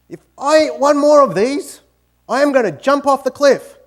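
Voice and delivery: loud, comic Aussie despair; hammed-up outburst. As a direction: shout it like a bloke at the end of his rope. Australian worker's punchline complaint.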